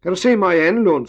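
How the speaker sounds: put-on high, loud boy's shout; yelling. A narrator's reading. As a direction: yell the question up to someone far above. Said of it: "skreg Emil".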